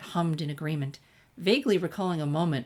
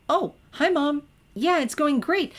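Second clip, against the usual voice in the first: cheerful phone voice; 10 semitones higher, 7 dB louder than usual. A narrator's reading. Cheerful phone call to mom.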